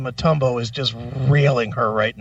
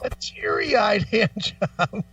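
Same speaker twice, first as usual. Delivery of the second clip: laughing; 6 semitones higher, much slower than usual. Real, not acted. Repeating caller's line, cracking up.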